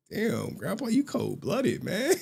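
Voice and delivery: high, softened retold protest; mock-wounded grandkid; hurt disbelief.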